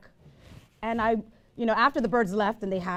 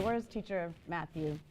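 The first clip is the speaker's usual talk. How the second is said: normal talking voice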